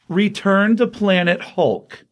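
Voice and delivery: slow, high, drawn-out title announcement; trailer voice announcement. Shown as a demonstration. Announcing the next comic title.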